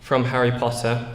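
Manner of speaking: ordinary delivery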